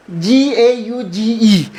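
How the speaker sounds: letters read out one by one, well apart; slow letter spelling. Shown as a demonstration. Teacher spells the word aloud for the class, slowly. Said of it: "इसे हम गेज पढ़ेंगे".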